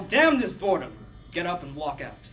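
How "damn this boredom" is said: loud, raised-pitch yell; performed yell of frustration. Acted. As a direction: yell it out in protest.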